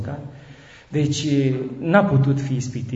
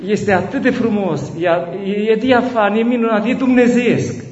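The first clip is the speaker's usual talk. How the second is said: rising, louder, building awe; rapturous; 6 semitones higher, 5 dB louder than usual. Real, not acted. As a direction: build in wonder to the peak. Rapture over the baby's crying. (entuziasm crescând, extatic)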